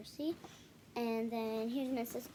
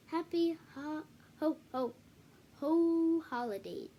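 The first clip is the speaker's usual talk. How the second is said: stumbling misread; 3 semitones higher, 7 dB louder, slower than usual. Real, not acted. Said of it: "Sorry, read that wrong".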